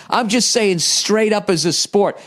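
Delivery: usual speaking voice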